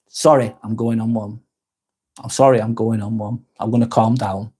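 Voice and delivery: ordinary talk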